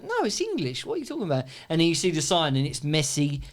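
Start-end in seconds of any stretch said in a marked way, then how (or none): 0.0-1.5 higher, indignant voice; indignant retold quote. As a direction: play it as offended disbelief. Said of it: "You go".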